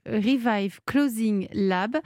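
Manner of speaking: slow English pronunciation of Clothing Lab; English accent. Real, not acted. Host reading the brand name on air, in an English accent. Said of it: "Elle a un très bel accent".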